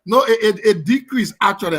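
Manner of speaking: loud, high objection; amused protest. Real, not acted. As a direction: protest in mock outrage. Protests pay decreased.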